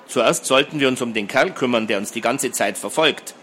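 ordinary delivery

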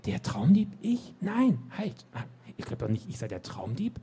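high, startled disbelief; shocked protest. Acted. Nick protests to the knights that he is no thief, shocked. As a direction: cry out in alarm at the accusation.

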